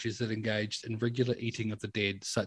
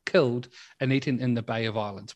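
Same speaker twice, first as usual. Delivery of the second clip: ordinary delivery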